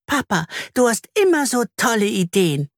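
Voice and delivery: put-on high, piping child voice; squeaky little voice. Little Anna pipes her praise to dad in a high squeak. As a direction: peep it out like a small girl. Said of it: "piepst Anna".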